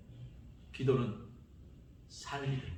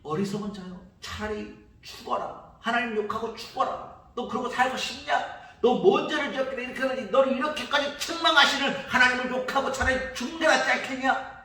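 higher, rising scorn, faster toward the end; berating her husband in contempt; scornful wife; 9 semitones higher, 3 dB louder than usual. Acted. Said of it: "그의 아내가 와서 말합니다". (욥의 아내가 남편을 비웃듯 다그치는 목소리)

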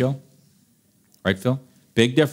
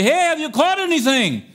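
mock holler. Fisherman hollering to another boat, a playful high call. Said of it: "someone hollers to you".

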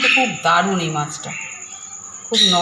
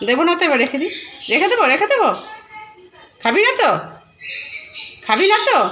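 teasing the pet; 12 semitones higher than usual. Real, not acted. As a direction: tease the pet, pitch way up. (পোষা প্রাণীকে খুব উঁচু গলায় খেলার ছলে খোঁচানো)

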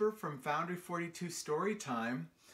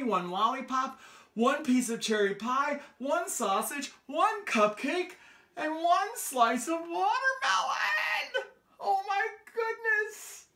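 climbs higher and louder on each food until a shrieking watermelon; building excitement; 10 semitones higher, 5 dB louder than usual. A narrator's reading. Reading to children, building from eager to an overjoyed shriek. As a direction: pile it up, each item higher, and burst. Storyteller builds list to climax.

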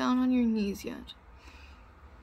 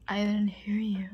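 whispering. Acted.

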